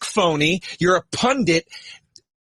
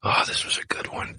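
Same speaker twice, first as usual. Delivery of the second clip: hushed whispered aside; soft unvoiced jab; 7 dB quieter than usual. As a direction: whisper it as a sly dig. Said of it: "I go".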